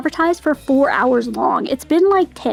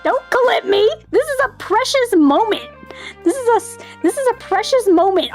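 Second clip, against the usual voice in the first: excited; 7 semitones higher than usual. Real, not acted. Streamer excited about game moment.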